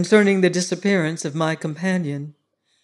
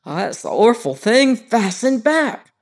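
higher and louder, drawing out fastened back; triumphant steward; 5 semitones higher, 6 dB louder than usual. Acted. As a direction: gloat that he was right all along. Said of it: "continued Robert triumphantly".

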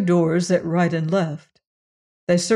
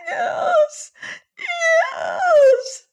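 very high wailing cry. Acted. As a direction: wail it out in despair. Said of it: "wailed the Stortulian".

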